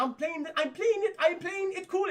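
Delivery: very high, frantic cartoon squeal; mock panicky insistence. Mocking Antoine claiming he's calm, high panicky squeal. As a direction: insist it in a rising cowardly whine. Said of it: "He's like".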